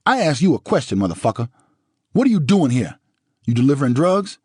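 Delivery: high, loud questioning; grilling the visitor in rage; angry demand. Acted. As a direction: press him hard. Interrogating Goldman angrily.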